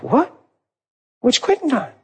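high, startled double take; surprised. Retold or imitated. Alone in the washroom, startled and confused. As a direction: caught off guard by the empty room.

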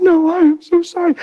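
high, insistent mimicked apology; crying wife. Wife refusing husband's comfort, high imitated tearful voice. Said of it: "And she's crying".

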